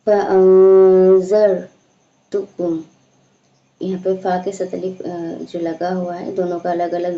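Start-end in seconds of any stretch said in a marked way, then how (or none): 0.1-1.6 slow, strong chanted recitation, louder; loud Quran recitation. Shown as a demonstration. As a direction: project the verse in a full recitation voice. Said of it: "teacher recites the new verse".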